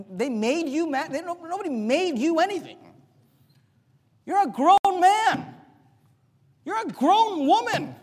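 preaching at full force; loud rebuke. Real, not acted. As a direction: call the grown men out from the pulpit.

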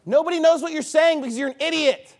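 high, indignant preaching outburst; scornful rebuke. Real, not acted. Preacher berating his opponent.

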